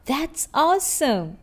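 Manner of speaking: be delighted for a friend; lifted, appreciative praise; happy excitement. Acted. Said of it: "It shows excitement and happiness".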